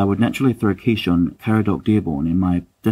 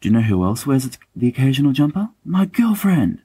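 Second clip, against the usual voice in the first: mock-gushing boast, pitch leaping higher and slowing on the last words; lovestruck James; 6 semitones higher than usual. Mocking James as he brags to friends about his girlfriend, rising mock gush. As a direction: crow it out like a smitten schoolboy. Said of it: "And James would say".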